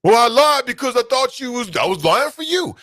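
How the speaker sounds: caught lying parent. Retold or imitated. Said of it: "you teaching your kids".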